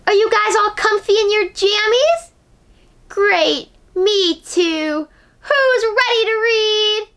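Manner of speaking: put-on high, bright host greeting to children; children's show host voice; kids' show host. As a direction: welcome the kids in pyjamas cheerfully. Host's upbeat kids' show greeting.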